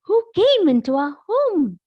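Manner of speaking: Mama Bear cries out, alarmed. Acted. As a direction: call out in alarm at the open door. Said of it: "Mama Bear said".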